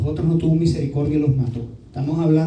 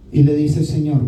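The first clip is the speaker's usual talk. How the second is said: normal talking voice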